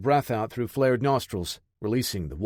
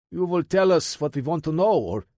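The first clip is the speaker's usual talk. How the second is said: put-on Russian accent; 5 semitones higher, 3 dB louder than usual. A narrator's reading. Russian interrogator threatening.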